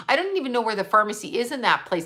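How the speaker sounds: ordinary talk, nothing marked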